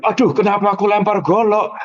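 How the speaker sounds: high, startled self-reproach. Retold or imitated. Startled at having thrown the machete, high. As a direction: gasp at what you just did. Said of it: "Habis itu kaget".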